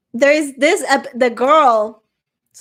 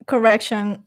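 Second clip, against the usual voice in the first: usual speaking voice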